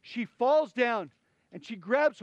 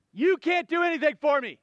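a loud, higher despairing plea; desperate cry; 8 semitones higher, 7 dB louder than usual. Acted. Mother crying out to the prophet in despair. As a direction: cry it out as a mother with nothing left.

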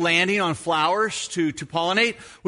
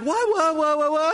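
higher, rapid panicked mother's questions; frantic mother; 10 semitones higher than usual. Retold or imitated. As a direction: ask like a mother chasing her screaming son. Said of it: "she's like".

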